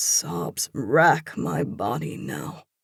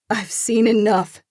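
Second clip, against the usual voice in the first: higher, louder hurt woman; upset woman's voice; 7 semitones higher, 7 dB louder than usual. A narrator's reading. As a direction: push him away, voice raised in hurt. Upset woman confronts lover.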